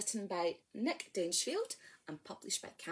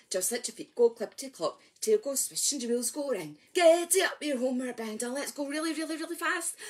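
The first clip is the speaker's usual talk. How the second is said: quick rushed chant; faster; 4 dB louder than usual. Acted. Leader rushing the horsey rhyme for children, fast. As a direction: gallop through the verse. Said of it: "go a little bit faster".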